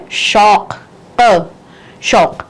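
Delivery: شوق drawn out slowly, ق from the throat; slow careful Urdu pronunciation. Shown as a demonstration. Teacher slowly drills the ق sound in شوق. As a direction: spell out the ق, then say شوق again slowly. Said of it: "ق کی آواز، حلق سے".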